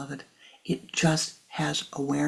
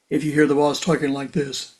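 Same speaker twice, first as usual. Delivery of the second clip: louder put-on boss voice; bossy voice; 2 semitones lower, 4 dB louder than usual. Shown as a demonstration. Teacher imitating the boss again in a louder put-on voice.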